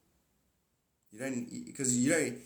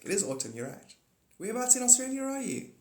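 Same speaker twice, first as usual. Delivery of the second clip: ordinary delivery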